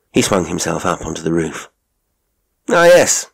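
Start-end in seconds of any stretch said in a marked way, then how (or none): 2.7-3.3 high, loud exclamation of discovery; exclaiming cry. A narrator's reading. Holmes spotting something from the roof, exclaiming cry. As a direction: call it out high on sudden discovery. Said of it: "he cried".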